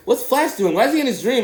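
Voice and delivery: high-pitched mock girlfriend voice; questioning girlfriend. Girlfriend interrupting the movie with questions, high mocking imitation. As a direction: pester him with high, mimicking questions. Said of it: "she's like".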